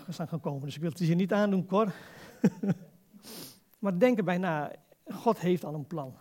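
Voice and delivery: normal talking voice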